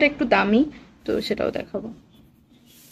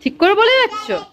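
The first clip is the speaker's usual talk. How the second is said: mock surprise; 10 semitones higher than usual. Real, not acted. Mother teasing son back.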